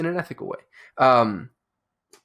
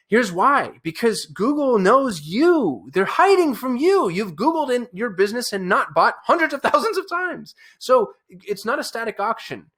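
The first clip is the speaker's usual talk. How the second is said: high-pitched, fired-up insistence; worked-up rant, pitch riding high; 7 semitones higher, slower than usual. Real, not acted. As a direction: drive the point home, fired up and high. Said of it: "I'm so fired up about this".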